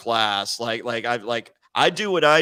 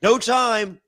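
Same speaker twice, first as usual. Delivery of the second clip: curt brush-off; 8 semitones higher, 5 dB louder than usual. Retold or imitated. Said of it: "He goes".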